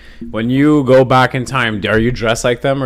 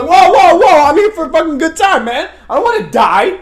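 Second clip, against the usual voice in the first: mock panic; 10 semitones higher than usual. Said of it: "And I'm like".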